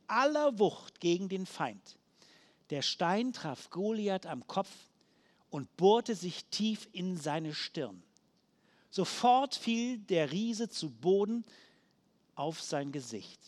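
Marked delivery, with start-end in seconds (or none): none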